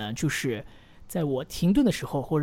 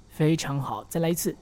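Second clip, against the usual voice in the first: normal talking voice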